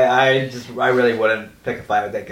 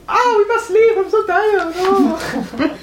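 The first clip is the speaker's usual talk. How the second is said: squeaky, very high mock whine; whiny high voice; much slower than usual. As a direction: whine it in a silly falsetto. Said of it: "I say".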